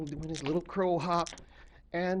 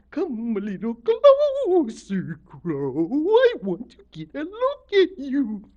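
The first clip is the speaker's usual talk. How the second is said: loud, drawn-out, swooping lure; beckoning octopus lady; 9 semitones higher, 12 dB louder, slower than usual. A narrator's reading. Octopus Lady beckons Crow closer, in a slow swooping creature voice. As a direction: coax him in with long gliding vowels. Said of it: "and she's beckoning him".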